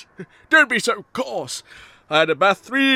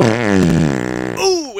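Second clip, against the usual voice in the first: low groan; 4 dB louder than usual. Acted. Villain breaks wind.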